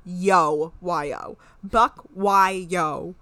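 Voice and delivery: "Buck, why, yo" in separate, evenly placed words; deliberate readout. Shown as a demonstration. Reading the Hulu caption to the co-host, "Buck, why, yo" said deliberately. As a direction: spell it out word by word: "Buck, why, yo".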